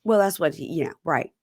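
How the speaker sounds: usual speaking voice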